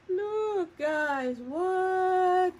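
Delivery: high, amazed little cries; excited squeal. Real, not acted. Telling viewers to look at the rib, high amazed squeal. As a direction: squeak it out in disbelief.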